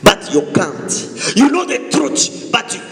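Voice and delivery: high, ringing preacher's cry; forceful preaching. Acted. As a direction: preach it out to the whole church at full force.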